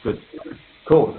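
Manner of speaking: normal talking voice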